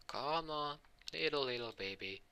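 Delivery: soft, high sing-song coaxing; cooing to baby. Acted. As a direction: coax a little one gently. Goku coaxes the baby.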